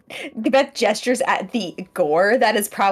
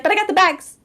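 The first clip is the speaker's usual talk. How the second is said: perky reassurance; 10 semitones higher, 6 dB louder than usual. Acted. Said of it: "She's like".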